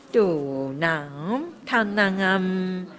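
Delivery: 嘟囔他囔啊 slow, each 囔 held long; long vowel demo. Shown as a demonstration. Teacher modelling mantra sounds for students, slow and held. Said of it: "这个有长音了".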